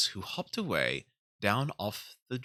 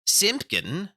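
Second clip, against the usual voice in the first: high, louder call to the cat; calling old tailor; 11 semitones higher, 6 dB louder than usual. Acted.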